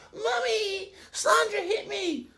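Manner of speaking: put-on child running to mum, whining; whiny child. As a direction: tattle to mummy like a hurt child.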